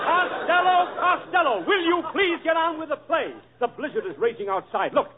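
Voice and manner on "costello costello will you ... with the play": calling out. Acted. Director calling the actor to order.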